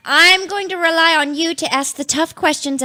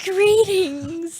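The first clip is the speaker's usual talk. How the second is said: imitating sculptor; 3 semitones higher than usual. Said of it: "wax sculptors say things like".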